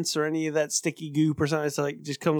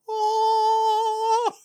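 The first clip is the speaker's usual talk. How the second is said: exaggerated gasp. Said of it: "It's like".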